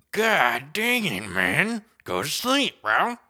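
put-on comic fed-up roar to a toddler; frustrated outburst. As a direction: let it out at the wriggling kid. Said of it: "I'm like".

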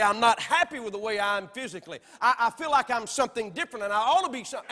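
loud, quick mock of a confused young person; mock confused youth. Preacher mocking what youth are pressured to say. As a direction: lampoon it with a laugh in the voice. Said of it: "pressured ... to say".

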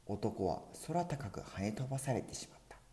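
plain reading voice

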